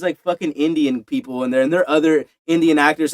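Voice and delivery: ordinary talk, nothing marked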